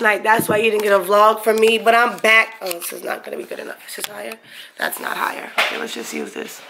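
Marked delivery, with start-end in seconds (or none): 2.6-6.6 much softer, half-whispered fiddling with the camera; muttering to self. Real, not acted. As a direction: mumble under your breath while setting up. Adjusting camera, talking to self.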